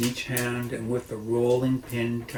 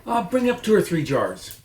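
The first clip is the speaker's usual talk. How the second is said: higher, louder calling voice; called back; 8 semitones higher, 7 dB louder than usual. Acted. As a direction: call down from upstairs as Mrs Bean. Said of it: "the other voice called back".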